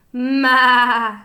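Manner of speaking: loud drawn-out goat ma; goat bleat. A narrator's reading. Goat boarding, a loud bleat. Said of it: "said Goat".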